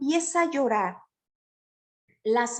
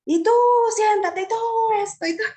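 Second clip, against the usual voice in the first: high, sharp barked orders at pupils; yelling teacher; 10 semitones higher, 5 dB louder, faster than usual. Shown as a demonstration. Teacher who yells all morning ordering pupils, sharp mock shouts. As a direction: boss the class around with shouts. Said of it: "un maestro que todo el día grita".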